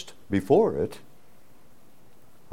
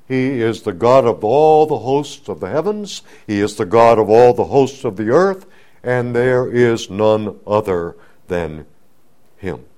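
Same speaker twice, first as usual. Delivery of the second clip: full-force preaching; 5 dB louder than usual. Acted.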